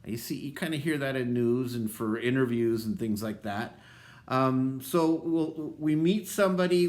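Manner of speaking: ordinary delivery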